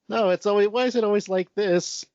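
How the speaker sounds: lightly dramatized exasperated wail; distressed lament. Retold or imitated. Retells Twice's distressed lament.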